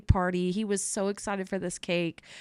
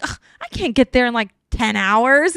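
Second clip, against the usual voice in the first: exasperated customer; 4 semitones higher, 9 dB louder than usual. Retold or imitated. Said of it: "she laughed at me".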